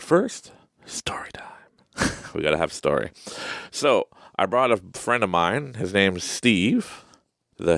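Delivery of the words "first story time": hushed, secretive whisper; whispered announcement. Acted. Host whispering to viewers that it's story time.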